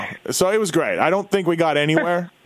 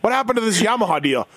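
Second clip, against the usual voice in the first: higher, faster incredulous retort; pitched-up mock disbelief; mock surprise; 7 semitones higher than usual. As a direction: act shocked at the Yamaha deal. Retold challenge to RV.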